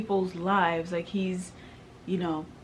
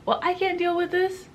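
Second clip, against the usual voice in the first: overwhelmed outburst; 12 semitones higher, 5 dB louder than usual. Real, not acted. Telling how she lost her parents, a high pained outburst. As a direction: voice jumps high in protest. Protesting God's burden, overwhelmed.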